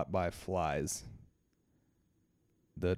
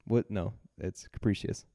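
usual speaking voice

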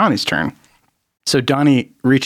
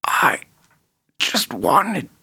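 fading gasp of a dying villain; dying villain confesses in a whisper; 5 semitones higher, slower than usual. Acted. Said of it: "he's like".

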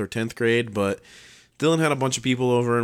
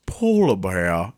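slow, stretched figurine voice, r's dropped; mock puppet voice, words drawn out; polar bear catchphrase; 3 semitones lower, 3 dB louder, much slower than usual. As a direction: drawl it like a silly catchphrase. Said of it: "holds up and just goes".

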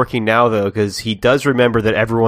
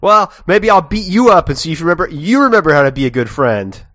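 louder, higher mock threat; bully him, hammed up; mock-menacing Sora, louder; threatening Sora; 6 semitones higher, 7 dB louder than usual.

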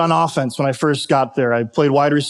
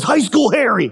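retold yell; 8 semitones higher than usual. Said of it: "yells at me".

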